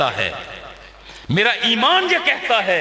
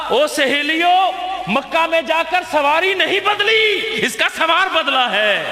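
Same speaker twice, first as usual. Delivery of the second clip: calling back; 6 semitones higher than usual. Acted. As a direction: answer proudly over your shoulder. Halima answers her companions.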